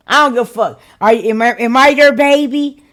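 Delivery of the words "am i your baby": ordinary delivery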